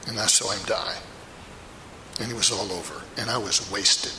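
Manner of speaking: low, soft, hollow voice; broken grief. Acted. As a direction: sink into quiet devastation. Peter recalls Jesus' death.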